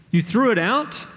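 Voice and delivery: high, incredulous rising question; mad disbelief. A narrator's reading. Augie confronting his dad over the helmet, incredulous and mad. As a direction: echo it back appalled, pitch shooting up. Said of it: "saw how mad I was".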